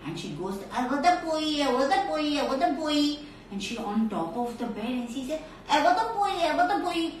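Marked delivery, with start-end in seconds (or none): 0.8-3.2 toothless grandma. Acted. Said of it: "because she doesn't have teeth". 5.7-7.1 high-pitched gummy granny chant; toothless grandma. Acted. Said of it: "because she doesn't have teeth".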